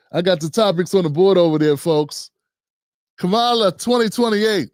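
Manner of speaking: normal talking voice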